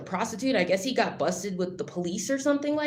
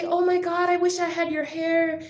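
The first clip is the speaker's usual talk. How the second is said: high gushing mom voice, much higher; imitating mom; 8 semitones higher than usual. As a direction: gush like an envious mother. Mother's quote, voiced in story.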